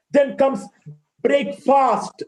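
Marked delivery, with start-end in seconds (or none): 1.2-2.2 "breakfast" as "brake-faast", full second vowel; wrong pronunciation. Shown as a demonstration. Teacher demonstrating the error, wrong pronunciation. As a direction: give "breakfast" a long open "faast".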